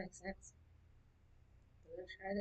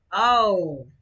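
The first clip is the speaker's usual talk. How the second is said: loud surprised oh; 3 semitones higher, 15 dB louder than usual. Real, not acted. Loud reaction to taste.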